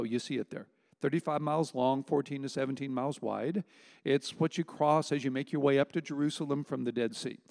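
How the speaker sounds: usual speaking voice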